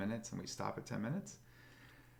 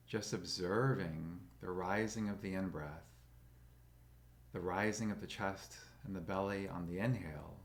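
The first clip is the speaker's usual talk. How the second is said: slow, calm meditation guide with long pauses; soothing, even voice, leaving space for breath; guided meditation; 2 semitones lower, 5 dB quieter than usual. Acted. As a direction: guide them gently into stillness, unhurried.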